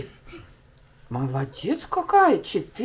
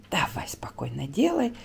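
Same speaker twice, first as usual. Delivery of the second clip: whispering; 5 semitones lower, 8 dB quieter than usual. Real, not acted. Coaxing crying son.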